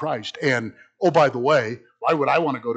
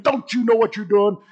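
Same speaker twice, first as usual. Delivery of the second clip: angry yelling; 9 semitones higher, 5 dB louder than usual. Retold or imitated. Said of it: "yelling and cursing and".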